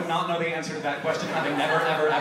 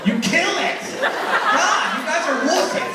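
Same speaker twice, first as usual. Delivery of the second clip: mock tough shout; 10 semitones higher, 3 dB louder, slower than usual. Real, not acted. Mock tough scolding of audience.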